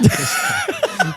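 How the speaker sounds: a big high laugh bursting out; burst of laughter. Real, not acted. Dad laughing about embarrassing his wife in public, loud high laugh. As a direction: crack up loudly, high and loud.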